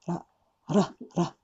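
ラ as a quick light tongue tap, no L; light flap ra. Shown as a demonstration. Teacher demonstrating the light flapped ra sound. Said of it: "こういう言い方ね".

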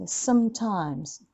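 slow "sometimes", short light s; slow model. Shown as a demonstration. Teacher modelling the word slowly for students. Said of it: "timesはむすじゃない".

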